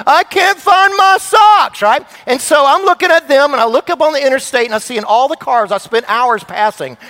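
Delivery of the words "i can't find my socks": high, slow, louder whine; child excusing himself to dad, high whine; whiny kid. Retold or imitated.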